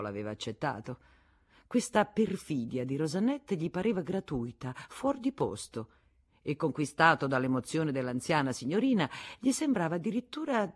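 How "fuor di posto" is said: ordinary delivery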